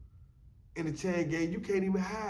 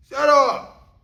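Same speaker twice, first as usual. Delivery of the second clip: higher and much louder barked shut up; yelled order; 8 semitones higher, 13 dB louder than usual. Retold or imitated. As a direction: cut him off with a shout. Cellmate yelling him quiet.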